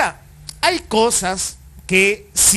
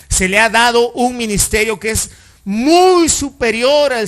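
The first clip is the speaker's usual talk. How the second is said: louder, ringing read of the verse; preaching scripture aloud; 5 dB louder than usual. Acted. Pastor proclaiming the verse to the congregation, loud and ringing. Reads scripture at full force.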